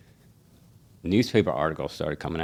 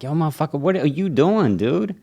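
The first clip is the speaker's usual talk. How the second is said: outraged; 10 semitones higher, 6 dB louder than usual. Retold or imitated. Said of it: "I'm like".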